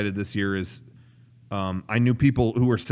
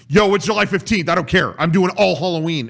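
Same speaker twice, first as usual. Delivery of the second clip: higher, louder mock enthusiasm; overeager fan; 5 semitones higher, 5 dB louder than usual. Imitating people starting Halloween in July, overeager. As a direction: imitate someone brashly announcing their plans.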